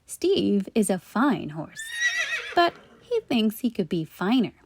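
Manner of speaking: ordinary delivery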